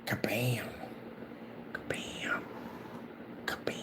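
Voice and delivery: unvoiced soft whisper; whispered painting sound effect. Real, not acted. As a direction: hush it like a secret. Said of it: "whispered while painting".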